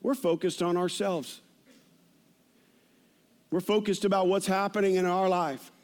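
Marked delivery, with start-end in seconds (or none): none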